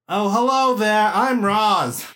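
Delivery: Roz voice. Acted. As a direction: greet it brightly as Roz. GM replies as Roz.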